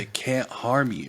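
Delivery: slow, giggling stoner drawl; spaced-out dude, laughing. Pretend. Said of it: "stoner voice".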